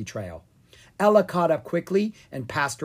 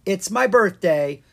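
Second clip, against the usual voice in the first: bright, louder boast; proud; 6 semitones higher, 7 dB louder than usual. Acted. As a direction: announce it proudly, a bit higher. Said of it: "Goldenrod proudly said".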